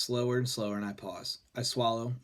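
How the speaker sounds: usual speaking voice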